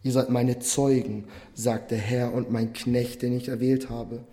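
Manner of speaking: full-voiced sermon reading of the prophet; preaching proclamation. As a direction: proclaim God's words to the congregation. Reads Isaiah building to proclaiming.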